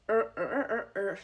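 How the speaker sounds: repeated R with the tongue back; R sound demo. Shown as a demonstration. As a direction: drill R, R, R. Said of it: "you're putting your tongue like this".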